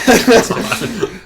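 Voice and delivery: high, loud burst of laughter. Real, not acted. Host cracks up at the Oregon Trail joke, high laugh.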